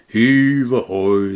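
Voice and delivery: sailors shouting. A narrator's reading. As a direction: call it out like sailors heaving ropes. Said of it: "they shouted".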